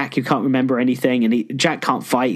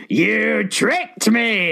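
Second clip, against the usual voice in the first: drawn-out, higher villain cry; outraged villain; 7 semitones higher, much slower than usual. Acted. Aku discovering Jack's fake swords, outraged. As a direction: play a tricked villain, very slowly.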